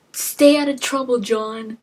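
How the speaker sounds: voicing the wife. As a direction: lightly play the worried wife seeing him off. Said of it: "And your wife is like".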